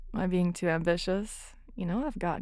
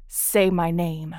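louder, slow and demanding; loud slow command; 8 dB louder, slower than usual. Acted. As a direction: draw each word out, commanding. Commanding partner in intimate roleplay.

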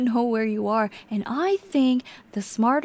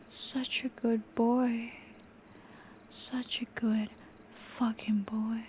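slow and soft, almost a whisper; creepy soft praise; 9 dB quieter than usual. Acted. Captor softly praising the submissive victim, creepily tender. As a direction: coo it like a twisted lullaby.

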